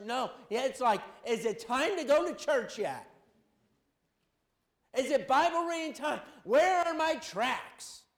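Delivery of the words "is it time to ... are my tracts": rising, eager, slowing on tracts; eager believer. Pretend. Churchgoer asking about church, eager and excited. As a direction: sound giddy to get to church.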